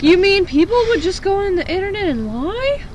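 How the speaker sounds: sarcastic disbelief. Acted. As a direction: feign naive surprise.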